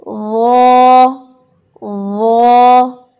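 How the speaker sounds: वो held long and loud with the whole tongue on the palate; heavy zoe. Shown as a demonstration. Teacher demonstrates the heavy letter वो. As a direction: draw out वो full and heavy. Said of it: "सारी ज़ुबान ऊपर तालू से लगती है".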